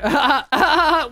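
high giggling laugh; gleeful laugh. Real, not acted. Sheriff shot the murderer.

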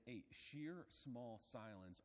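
drawn out slowly; a sheer small silence spoken very slowly; slow, savoured phrase. Shown as a demonstration. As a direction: let each word hang. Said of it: "The literal translation here is".

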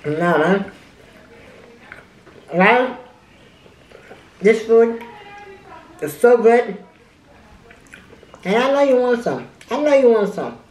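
muffled words between bites; talking while chewing. Real, not acted. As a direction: mouth full of food. Seen 2: Bit sandwich at -5; lips closed, chewing throughout labelled words.

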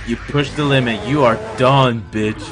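normal talking voice

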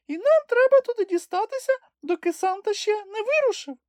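very high squeaky little creature voice; polite eager piping explanation; Kulka explaining. A narrator's reading. Kulka explains to the Captain they need Santa, squeaky eager voice.

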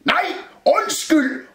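high, loud flustered yell; confused shout. A narrator's reading. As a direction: shout a muddled correction. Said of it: "råbte Rumle forvirret". (råber forvirret)

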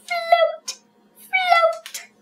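high drawn-out sing-song; floaty high call. Pretend. Acts out floating frog.

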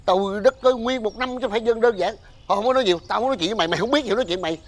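high, irritated dismissal; noisy quarrelling. Acted. Older man refusing to argue with a neighbour at Tết, quarrelsome. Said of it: "cái gì mà Tết nhất mà um sùm vậy?".

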